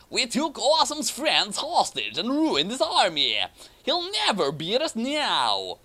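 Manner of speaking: high, gleeful villain voice; gloating villain. Acted. Ron Paul gloating to Schneisel, high villain crow. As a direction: crow over the captured friends.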